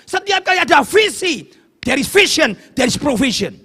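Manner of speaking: loud, high, fervent preaching; preaching at full force. Acted. Preacher warning the congregation against self-chosen paths, fervent shout. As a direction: drive the warning home to the congregation.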